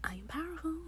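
whispered affirmation, breath only; hushed whisper of the same words. Acted. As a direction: whisper it like a secret to yourself. Whispered repeat.

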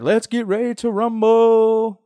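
high, slow, drawn-out ring announcer call; booming fight-night intro; Michael Buffer announcer. Retold or imitated. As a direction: stretch it out like a boxing announcer. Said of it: "He's the one that's like".

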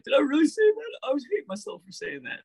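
very high-pitched mock self-reproach; hammed-up falsetto regret; self-doubting star. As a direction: squeal the doubt in a put-on high voice. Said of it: "he's just beating himself up".

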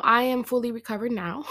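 normal talking voice

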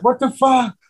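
a high disbelieving cry; shocked outburst. Retold or imitated. Boy's foot goes through the floorboard, high shocked cry. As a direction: imitate the kid whose foot went through the floor. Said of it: "I'm like".

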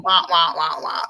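muffled trombone wah wah; Charlie Brown adult wah wah. Retold or imitated. Mocking a lecture nobody hears, wah wah. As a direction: mimic the teacher drone. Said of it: "the Charlie Brown".

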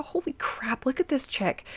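hushed, amazed whisper from an onlooker; awed whisper. Retold or imitated. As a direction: whisper it as if you can't believe your eyes. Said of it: "They're saying, you know".

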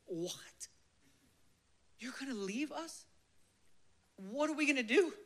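3 play the stunned disciples, climbing to a cry; 1 alarmed disciples. Acted. 4 disciples reacting to Jesus leaving, alarmed rising disbelief. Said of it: "And they're like".

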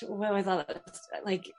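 ordinary talk

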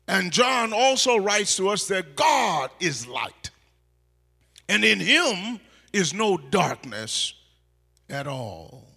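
loud, rising pulpit preaching; preaching at full force. Acted. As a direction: preach it out to the whole church.

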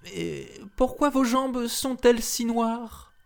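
put-on high, wondering child's voice; little girls' voice. A narrator's reading. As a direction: ask it like a curious little girl. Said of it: "lui demandèrent".

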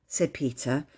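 ordinary delivery, nothing marked

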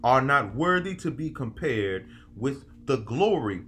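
preaching scripture. Acted. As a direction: read it like a sermon from the pulpit. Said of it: "This man said".

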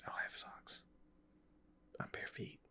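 hushed aside; whispering. Real, not acted. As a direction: whisper it during the quiet. Whispered reply during experiment.

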